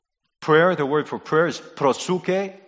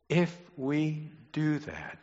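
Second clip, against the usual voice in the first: softly, word by word; slow right down and drop the volume; slow, hushed caveat; 2 semitones higher, 7 dB quieter, slower than usual. Real, not acted. Conditional caveat after the promise.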